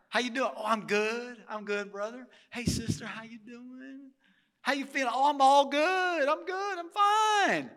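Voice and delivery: a hint of hollow hallway chatter; fake church greeting. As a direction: play the polite pew greeter.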